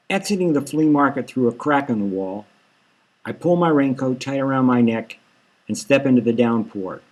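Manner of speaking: plain reading voice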